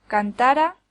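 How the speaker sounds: cantara with the beat on TA, can-TA-ra; stress on middle syllable. Shown as a demonstration. Teacher contrasts words by accent, cantara stressed on TA. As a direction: lean on the second syllable of cantara. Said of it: "cambiar el acento de lugar".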